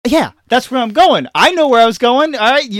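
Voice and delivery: put-on high, loud indignant kid; hammed-up backtalk; mock defiant sass. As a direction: act vindicated and fed up with mom. Kid sasses mom who caught up.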